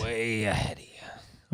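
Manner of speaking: slow breathy whisper. Acted. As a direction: whisper it back slowly. Echoing the line as a whisper.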